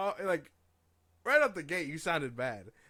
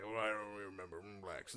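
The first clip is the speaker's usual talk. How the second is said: mock forgetful old man; 7 semitones lower, 9 dB quieter, faster than usual. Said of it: "he's just like".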